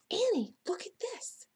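high-pitched call to get attention. A narrator's reading. Jack calling Annie to look, calling out. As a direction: call out excitedly, pitched well up. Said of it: "Jack called".

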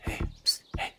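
unvoiced whisper to get attention; a sneaky little whisper. Real, not acted. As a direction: psst them quietly from behind. Sneaking up on players.

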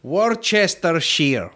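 Worcestershire as wor-chester-sheer; spelled-out pronunciation. Shown as a demonstration. Showing how you should say it, Worcestershire slowly as wor-chester-sheer. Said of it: "That's probably how you should say it".

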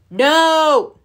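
a big loud storybook NO; shouted refusal. Acted. Bear turning away his friends, loud shout. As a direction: bellow it out like a grumpy bear.

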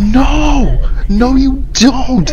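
loud, high yell of refusal; panicked shout. Real, not acted. Player found by the enemy, high shouted refusal. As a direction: yell it out high, drawing out the words.

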